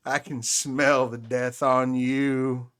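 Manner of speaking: slow creepy vagrant voice; slow ominous raspy drawl. Acted. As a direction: creep them out, slowly and darkly. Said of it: "In, like, a Sylvia Plath sort of way?".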